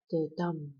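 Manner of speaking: unstressed "the", then stressed "dum"; weak "the" leading into a strong "dum"; iambic stress. Shown as a demonstration. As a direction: land the beat on "dum". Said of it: "non-stressed syllable and a stressed syllable".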